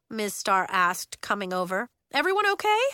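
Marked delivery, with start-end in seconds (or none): 2.1-2.8 higher, louder check; teacher calling. Acted. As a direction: call the question.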